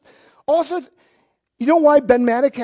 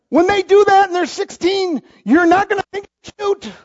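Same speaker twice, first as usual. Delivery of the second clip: raised warning; 8 semitones higher, 3 dB louder than usual. Real, not acted. Preacher warning parents.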